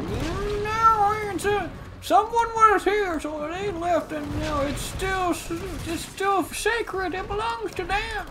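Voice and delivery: very high, drawn-out silly voice; mock sacred-slot defender. Mocking the game's old slot rule, squeaky put-on voice. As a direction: squeal it slowly in a put-on pitch. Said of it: "World just still was just like".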